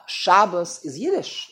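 ordinary talk

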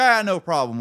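chirpy dismissive service guy; breezy mechanic. Retold or imitated. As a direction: toss it off like a breezy shop clerk. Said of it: "They go".

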